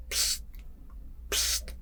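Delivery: short, quiet "psst" cue; soft hissed signal. Retold or imitated. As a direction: give a soft hiss as a silent cue. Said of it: "and he just gives it".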